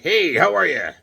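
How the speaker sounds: playful toy voice, a bit high; Hawkman. Pretend. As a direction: greet loudly as a chilling action figure. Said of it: "Here's Hawkman chilling".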